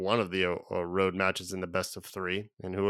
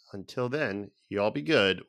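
normal talking voice

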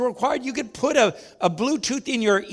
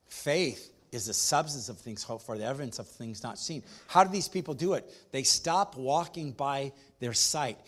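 softer, earnest pulpit voice; hushed preaching; 8 semitones lower, 8 dB quieter than usual. Acted. Pastor warns the church about doubt, hushed and earnest. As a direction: draw them in with a low, earnest hush. Quiet intimate stretch of the sermon.

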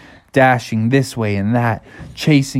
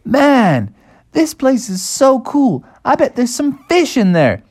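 much higher, gleeful character voice; excited Bubby; 13 semitones higher than usual. Acted. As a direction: whoop it up like an eager cartoon kid. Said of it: "Bubby was like".

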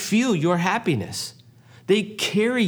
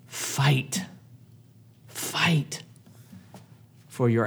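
whispered fight; 5 dB quieter, much slower than usual. Acted. Repeated word, second whispered.

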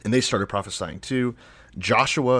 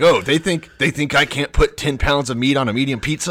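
higher and louder, indignant showman; offended boast; 6 semitones higher, 8 dB louder than usual. Acted. Papa John insulted by doubters, loud indignant boast. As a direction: bellow it like an insulted pizza king.

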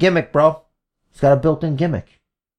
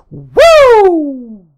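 loud high wrestler's woo; Ric Flair woo; 10 semitones higher, 6 dB louder than usual. Acted.

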